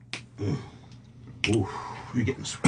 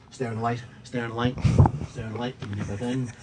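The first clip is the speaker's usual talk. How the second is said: hypnotist voice; 5 dB quieter, faster than usual. Acted. Hypnotist puts subject under.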